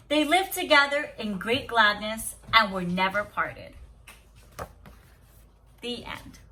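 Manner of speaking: steady storybook reading to a class; story read aloud. Acted. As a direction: read the tale calmly to the children. Teacher reads story aloud.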